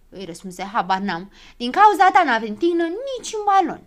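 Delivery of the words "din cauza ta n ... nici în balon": loud, high-pitched complaint; angry accusation. A narrator's reading. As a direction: blame him hotly. Acted quarrel in the balloon.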